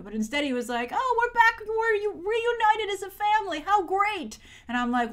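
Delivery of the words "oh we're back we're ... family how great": higher, sing-song mock delight; sarcastic parody of a happy reunion; mock cheerful. Mocking Sherlock about his family reunion, in sarcastic high glee.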